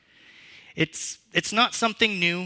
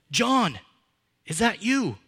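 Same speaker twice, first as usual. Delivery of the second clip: a father calling out into the dark, higher; a mild call, higher and louder; 6 semitones higher, 4 dB louder than usual. Retold or imitated. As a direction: call the name out across the forest.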